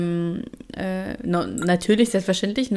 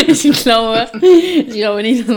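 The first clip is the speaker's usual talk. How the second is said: laughing; 3 semitones higher, 7 dB louder, slower than usual. Real, not acted. Laughs at own story.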